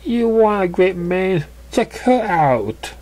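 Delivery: voiced pony. Pony character points to friend.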